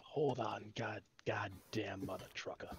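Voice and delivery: hushed curse under the breath; whispered cursing. Real, not acted. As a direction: whisper it to yourself, annoyed. Frustrated at pasting, swearing quietly.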